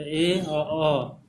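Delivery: ए and अ very slowly sounded out; two vowel sounds. Shown as a demonstration. Teacher isolating the sounds heard in फेयर, very slow vowels. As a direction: hold ए, then अ, as separate sounds. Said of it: "ए और अ का sound".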